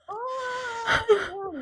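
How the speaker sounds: high-pitched mock wail; imitated pained cry. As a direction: wail as if just hit in the eye. Struck man cries out in pain.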